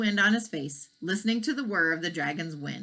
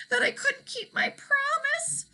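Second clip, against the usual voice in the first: high, teary apology; sorry through tears; huffing, tearful. Acted. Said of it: "huffed the dragon".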